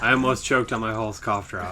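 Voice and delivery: usual speaking voice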